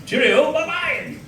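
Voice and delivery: very high, drawn-out mock-posh goodbye; posh sign-off. Signing off to officer friend, high mock-posh sing-out. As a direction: trill a slow, hammed-up posh farewell.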